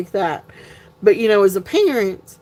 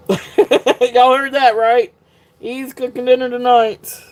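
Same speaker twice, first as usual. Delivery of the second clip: laughing aside; 4 semitones higher, 4 dB louder, slower than usual. Real, not acted. Laughing to viewers about husband.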